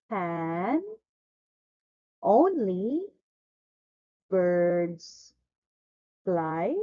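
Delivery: one word at a time, long pauses between; word-by-word model. Shown as a demonstration. Teacher has pupil repeat the question, word-by-word model. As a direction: separate every word for the learner to echo. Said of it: "Please repeat".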